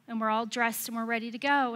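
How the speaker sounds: ordinary talk, nothing marked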